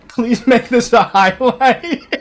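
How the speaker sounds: laughing. Real, not acted. Laughing at own bit.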